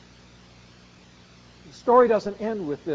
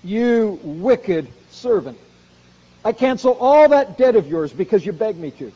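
angry master; 3 semitones higher, 9 dB louder than usual. Retold or imitated. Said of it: "Then the master called the servant in".

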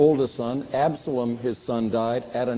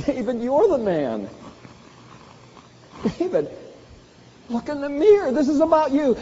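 gentle sorrowful rebuke; 10 semitones higher than usual. Acted. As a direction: say it like a grieving friend. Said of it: "I don't know whether he said it".

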